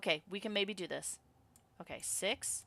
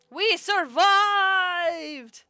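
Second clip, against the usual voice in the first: high, loud, long-held final word; triumphant cheer; 13 semitones higher, 17 dB louder, much slower than usual. Real, not acted. Player celebrating survival, triumphant stretched shout. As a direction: belt out a drawn-out victory cry.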